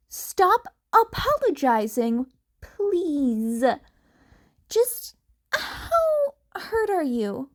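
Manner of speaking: worried friend begging for the truth; worried Uraraka. Acted. Uraraka presses Izuku about his injuries, pleading.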